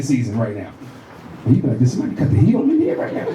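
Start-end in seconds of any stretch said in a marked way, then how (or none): 1.8-3.3 high, quick puzzled question; mock bewilderment at sudden heat; baffled complaint. Acted baffled reaction to exhaling sleeper.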